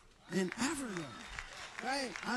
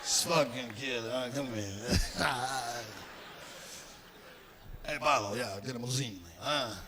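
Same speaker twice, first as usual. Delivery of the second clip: doting mobster; 10 semitones lower, 4 dB quieter than usual. Acted. Mobster voiced again, low.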